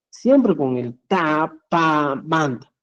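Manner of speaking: "Siempre con el ta" said very slowly, every word spaced; slow stressed teaching. Shown as a demonstration. Teacher slows down so the class can catch every word. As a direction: spell out the rule slowly for students.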